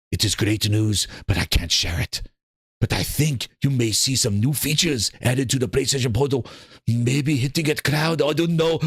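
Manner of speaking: low, mumbling, coy executive teasing news; Herman Holtz impression. Streamer mocks Herman Holtz teasing Portal news, low coy voice. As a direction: sink the voice low and be cagey. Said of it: "Herman Holtz to sit down on a panel going".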